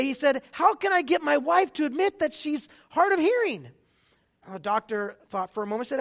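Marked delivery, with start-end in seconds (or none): 0.5-3.7 a higher, louder worried husband; husband asking. Acted. Husband asking the doctor, raised worried voice. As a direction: play the puzzled husband asking his doctor. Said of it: "speaking to his doctor one day".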